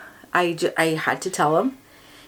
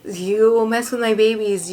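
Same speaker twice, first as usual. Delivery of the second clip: fighting back tears while talking about her babies; choked up, pitch rising with emotion; tearing up; 5 semitones higher than usual. Real, not acted. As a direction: voice going high as real tears come. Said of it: "you get the waterworks".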